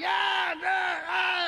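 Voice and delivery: high, drawn-out yelps; hammed-up high screams, one after another; mock screaming. Comic answering the screaming woman in his story, high mock screams. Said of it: "And she's screaming, and I'm like".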